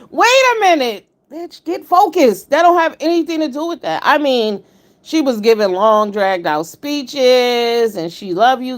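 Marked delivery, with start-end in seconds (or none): 0.1-4.0 high, loud mock scolding, the last words slowed; a mock yell of wait a minute, get focused; retold exasperated outburst. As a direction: snap her back on task with a half-serious yell. Her own retold outburst at the host.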